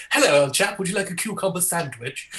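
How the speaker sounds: hammed-up posh English caricature. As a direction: put on an old-chap British accent. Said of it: "stereotypes of British people from outside of Britain".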